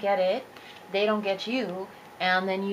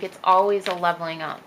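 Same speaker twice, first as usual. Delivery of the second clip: normal talking voice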